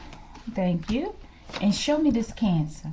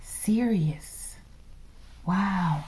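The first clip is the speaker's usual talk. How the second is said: ordinary delivery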